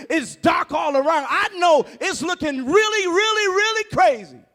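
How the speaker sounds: loud, rising sermon; preaching at full force. Acted. Preacher rouses congregation, shouting with rising fervor. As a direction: drive it home to the congregation.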